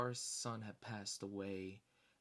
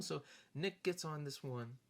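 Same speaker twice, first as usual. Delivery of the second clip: usual speaking voice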